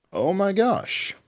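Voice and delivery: high, slow drawn-out oh my gosh; surprised gasp. Retold or imitated. Telling the sister, surprised at who is appearing, high and slow. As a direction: let the surprise stretch the words out. Spotting the actress unexpectedly at show.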